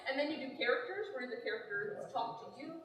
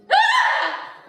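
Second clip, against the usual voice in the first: burst of high laughter; 15 dB louder than usual. Real, not acted. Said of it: "He screams".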